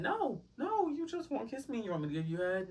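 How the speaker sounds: squeaky high disbelief; can't believe what he just asked; incredulous retort. Retold or imitated. Her incredulous reply to his request.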